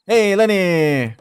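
loud, slow, gloating call; loud slow gloating hail; taunting call. Real, not acted. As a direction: rub the win in, drawn out. Taunting call after winning.

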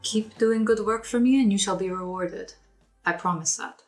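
usual speaking voice

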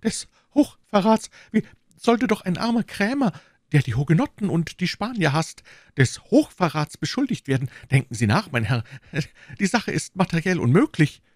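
high-pitched, frightened protest; alarmed. A narrator's reading. Shopkeeper denying high treason to the commissioner, alarmed and high. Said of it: "rief Bonacieux erschreckt".

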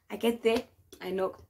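ordinary delivery, nothing marked

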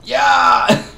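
high, loud cheer of victory; triumphant yell. Real, not acted. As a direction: shout it out in triumph. Hits enemy, triumphant yell.

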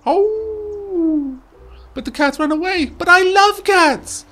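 very high, slow pleading pup; high dog voice. Acted. Scruffy the dog pleads he loves cats, high and whiny. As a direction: whine it like a sad puppy. Dog's dialogue.

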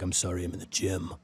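lightly imitated superhero voice, low and soft; Batman voice. Friend quoting a gym excuse text, light Batman impression. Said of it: "with that sweet, like, Batman voice".

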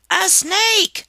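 shrill high scream of fright. A narrator's reading. Harriet spots the snake and screams in terror. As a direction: shriek it at the top of your voice. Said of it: "she screamed".

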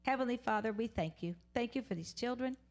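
ordinary talk

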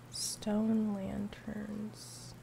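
drawn out. Real, not acted. Slow repeat to herself.

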